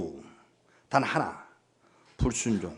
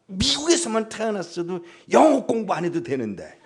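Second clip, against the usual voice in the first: put-on whining kids; 5 semitones higher than usual. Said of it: "이 아이들 보고 물어봐".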